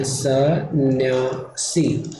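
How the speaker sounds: सन्यासी drawn out very slowly, part by part; spelling out. Shown as a demonstration. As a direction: dictate सन्यासी slowly as if writing it. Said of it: "लिख के बता रहा हूँ". (सन्यासी को धीरे-धीरे तोड़कर बोलना)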